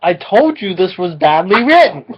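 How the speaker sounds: normal talking voice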